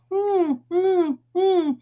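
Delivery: high hummed kissing noises; mock kiss hums. Imitating her son blowing kisses at the next car, high hummed kisses.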